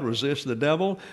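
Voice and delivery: ordinary talk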